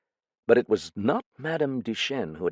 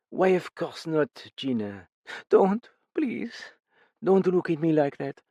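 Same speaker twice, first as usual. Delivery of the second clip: higher, gently begging; tired older woman, pleading; 8 semitones higher than usual. A narrator's reading. Older woman begging the frightened guest, gently higher. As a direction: soothe her frightened friend. Madame Duchesne speaks.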